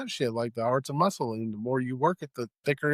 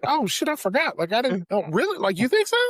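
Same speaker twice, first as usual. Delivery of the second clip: high, sarcastic faked shock; high mock gasp of disbelief; mock surprise; 9 semitones higher than usual. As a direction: play dumb, pretending to be stunned. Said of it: "You'd be like".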